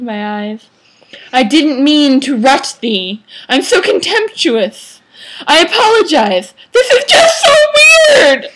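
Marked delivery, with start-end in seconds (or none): 1.3-6.5 high, pleading mock lament; hammed-up contrite whine; melodramatic apology. Vampire boy apologizes melodramatically. 6.7-8.5 slow, very high, loud cry; hammed-up anguished yell; overwrought wail. Vampire boy's overwrought outburst.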